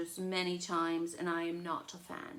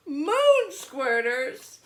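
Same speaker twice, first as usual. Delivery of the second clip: very slow, louder, rising; stunned disbelief; 7 semitones higher, 12 dB louder, much slower than usual. Acted. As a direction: echo it in horror. Charlie in disbelief.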